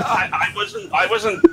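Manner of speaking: high defensive yelp; flustered protest. Real, not acted. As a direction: protest that you did no such thing.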